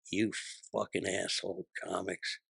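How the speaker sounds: hushed, measured venom; retold seething anger. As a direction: curse them softly behind a closed door. Vice president behind locked door, cursing.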